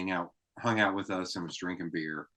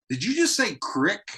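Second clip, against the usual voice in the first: high-pitched, louder incredulous question; baffled disbelief; 12 semitones higher, 5 dB louder than usual. Retold or imitated. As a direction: act out baffled disbelief at a word. Baffled by rural Southern speech.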